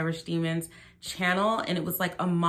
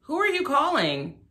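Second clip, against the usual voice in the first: incredulous; 10 semitones higher than usual. Real, not acted. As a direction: ask it in disbelief. Incredulous at the clip.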